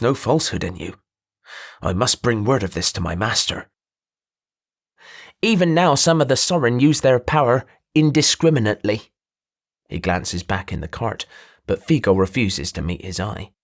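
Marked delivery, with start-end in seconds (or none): 5.4-9.1 nasal, higher-pitched merchant voice; a showy trader pinching his voice through the nose; nasally voice. Acted. Merchant introduces himself to the druid, nasal and higher.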